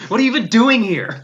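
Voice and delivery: mock exasperated. Acted exasperated boyfriend.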